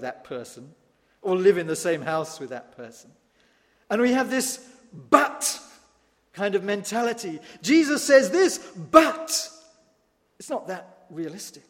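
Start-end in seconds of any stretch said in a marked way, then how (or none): 4.9-5.6 "but" punched high and loud; emphatic but. Acted. Preacher mocking our excuses, punching "but". 7.6-9.5 "but" punched high and loud after a louder lead-in; mock excuse. Preacher voicing the believer's excuse, loud emphatic "but".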